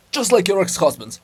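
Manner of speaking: jaunty. A narrator's reading.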